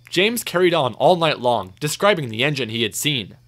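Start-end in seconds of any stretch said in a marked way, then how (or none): none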